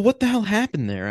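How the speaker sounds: normal talking voice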